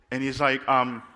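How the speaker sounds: normal talking voice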